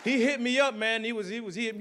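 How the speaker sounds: usual speaking voice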